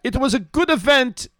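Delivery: high, loud German officer voice; Colonel Klink. Retold or imitated. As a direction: bark it like a comic German colonel. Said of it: "You do a Colonel Klink".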